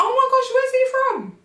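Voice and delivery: high, squealing gossip voice; mock excitement. Friend hearing about a new boyfriend, high excited squeal. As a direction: gush over the news. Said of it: "I'm like".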